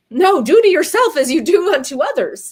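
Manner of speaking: punchy corrected proverb; emphatic correction. Real, not acted. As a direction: set the saying straight firmly.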